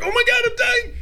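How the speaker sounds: high-pitched, frantic cry; mock panic. Climber retelling a missed grab at the top, high mock panic. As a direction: squeal it in hammed-up terror.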